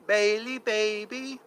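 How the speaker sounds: ordinary talk, nothing marked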